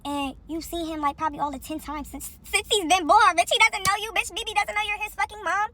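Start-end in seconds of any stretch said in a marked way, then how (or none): 2.4-5.7 raised, higher-pitched indignant retort; heated comeback. Retold or imitated. Woman retelling her argument over the baby, heated and higher. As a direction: build into a loud, high-pitched telling-off.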